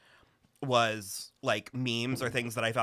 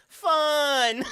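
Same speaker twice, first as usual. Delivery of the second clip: high mock cheer; 13 semitones higher, 6 dB louder than usual. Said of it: "she just goes".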